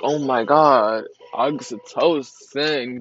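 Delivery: loud, slow, drawn-out gushing; overwhelmed fan outburst. Acted. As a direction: stretch it out in mock ecstasy. Acts out fan reaction.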